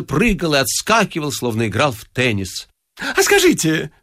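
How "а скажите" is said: very high, excitable little voice; hurried questioner. A narrator's reading. Bouncing stranger accosting Ostap, hurried high-pitched asking. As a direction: pipe up eagerly, far above your own pitch. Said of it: "поспешно спросил он Остапа".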